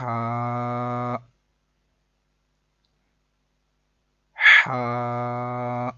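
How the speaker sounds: ہا from the middle of the throat, vowel held long and level; throaty haa. Shown as a demonstration. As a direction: breathe ہا out of mid-throat and sustain it. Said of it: "حلق کے درمیان والے حصے سے ادا ہوتا ہے". (ہا حلق کے درمیان سے، لمبا کر کے)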